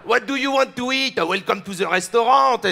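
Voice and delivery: hammed-up comedian's French accent; mock French accent. Comedian imitating a French waiter greeting diners, exaggerated French accent. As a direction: lay the cliché French accent on thick. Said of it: "doing the French accent".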